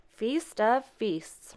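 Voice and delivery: put-on very slow, savoured, in a changed old voice; murmured. A narrator's reading. Mister Whiskers admiring the dinner, murmuring. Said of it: "murmured Mister Whiskers".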